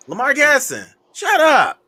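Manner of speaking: high teasing shout; playful shut up. Real, not acted. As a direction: tease a friend loudly. Teasing viewer.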